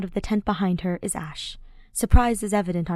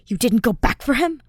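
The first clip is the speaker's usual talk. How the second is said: louder, higher, outraged; furious accusation; 5 semitones higher, 7 dB louder than usual. A narrator's reading. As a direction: hurl it at him. Felix confronts the soldier.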